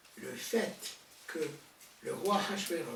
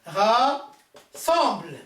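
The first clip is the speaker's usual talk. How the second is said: "Rassemble" drawn out very slowly and loud; slow stressed rassemble; 4 semitones higher, 12 dB louder, much slower than usual. Shown as a demonstration. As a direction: stretch out "Rassemble" with weight. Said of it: "dis-moi s'il vous plaît". (« Rassemble » très lent et appuyé)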